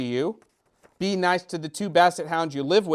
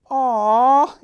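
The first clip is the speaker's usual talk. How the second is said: sweet aww; 7 semitones higher, 7 dB louder than usual. Shown as a demonstration. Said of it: "makes you go".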